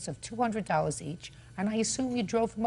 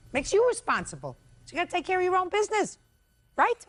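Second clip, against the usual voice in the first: scolding; 10 semitones higher, 4 dB louder, faster than usual. Real, not acted. Judge lectures plaintiff.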